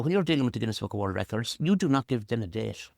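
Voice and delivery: ordinary talk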